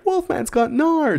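very high, slow mock kid voice; famous movie quote. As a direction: draw it out high in imitated amazement. Quoting the kid's famous line.

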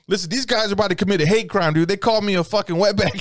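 put-on victim's protest; urgent complaint. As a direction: rush it out high to security. Retold urgent complaint to security.

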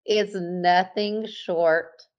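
slow, drawn-out emphasis; slow emphatic gush. Real, not acted. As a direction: savor each word slowly. Gushing about perfect weather.

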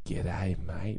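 low, soft mock-seductive whisper. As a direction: whisper it like a cheesy lover. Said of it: "softly kiss your neck and whisper".

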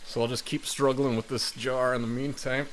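normal talking voice

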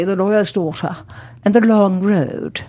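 "lawyer's daughter" and "long road" in careful British vowels; learned elocution. Shown as a demonstration. Student showing what she spent summer learning, refined British. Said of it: "learning how to say".

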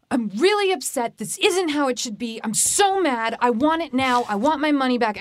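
mock a rude demanding caller; nasty upset customer. Customer snapping at a phone rep, high and nasty. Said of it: "How nasty is that?".

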